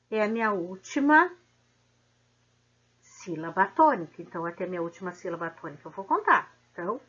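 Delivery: normal talking voice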